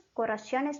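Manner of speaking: corazones said as "co-ra-SYO-nes"; wrong plural. Shown as a demonstration. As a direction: corazones with a mistaken i-glide before "ones". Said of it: "corazones no existe".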